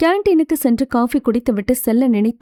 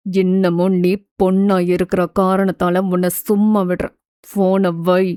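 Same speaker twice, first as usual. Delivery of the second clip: low, stern male voice; angry rejection; 5 semitones lower than usual. Acted. Krishna coldly rejecting the woman who keeps calling. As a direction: voice the man, disgusted and firm. Man angrily rejecting caller.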